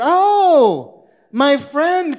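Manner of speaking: high, loud, over-the-top delighted greeting; gushing welcome. Acted. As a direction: fawn over the rich-looking guest.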